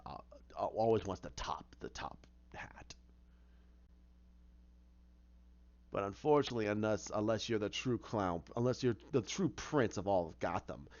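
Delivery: low, hushed, whispery villain monologue; Joker voice. Retold or imitated. Joker whispers to Penguin through the door, low and gloating. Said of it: "Joker on the other side of the door going".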